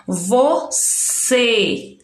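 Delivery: "você" drawn out, ending on a closed ê; closed final vowel. Shown as a demonstration. Teacher sounding out a word for pupils, closed vowel in "você". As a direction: split "você" slowly, tight final ê. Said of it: "fique com um som fechado".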